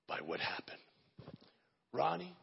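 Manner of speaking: ordinary delivery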